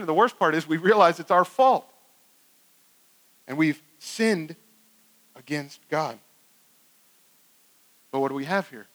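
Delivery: ordinary delivery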